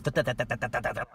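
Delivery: loud imitated babble of the woman; mock gibberish. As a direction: imitate her babbling nonsense, loudly. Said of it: "she starts talking in gibberish".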